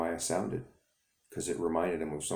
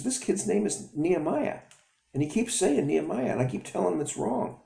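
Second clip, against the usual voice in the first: put-on high, indignant little boy; high kid voice; 14 semitones higher than usual. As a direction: tattle like a young boy. Boy complains to father.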